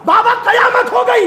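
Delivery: son crying out to his father in dread; wailing shout; anguished cry. Acted.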